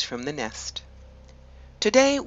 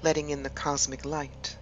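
measured meditation voice with long pauses between phrases; guided meditation; 6 dB quieter than usual. Acted. Host guiding listeners to relax and imagine an ancient wood, guided meditation. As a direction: lead them gently inward, leaving space after each phrase.

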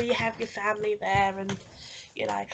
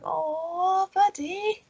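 tender; 9 semitones higher, much slower than usual. Retold or imitated. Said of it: "It's like".

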